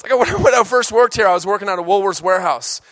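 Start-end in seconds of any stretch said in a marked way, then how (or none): none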